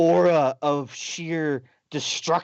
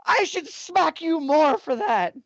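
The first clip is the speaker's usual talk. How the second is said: put-on pouty girl; 13 semitones higher, 3 dB louder than usual. Voicing the demon girl.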